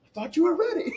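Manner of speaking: high, loud, laughing imitation; mock retort yell. Quoting the pilot's retort after the rough dive, high mock yell.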